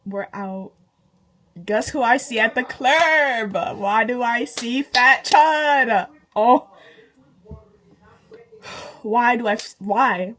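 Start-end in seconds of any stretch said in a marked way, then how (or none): 1.6-10.4 loud, slowed, drawn-out disbelief; spotting the ex at the club, loud disbelief; outraged disbelief. Retold or imitated. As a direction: hammer it out loud and slow.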